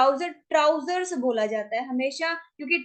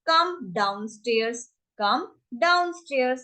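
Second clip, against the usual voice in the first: drawn-out teaching delivery; slow demo phrase; slow model phrase; much slower than usual. Shown as a demonstration. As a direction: stretch it so learners can follow. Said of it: "तो हम क्या कहेंगे".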